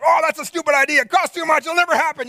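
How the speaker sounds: high scornful shootdown; mock naysayer; dismissive fire hose. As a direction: sneer the idea down, pitched high. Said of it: "fire hose".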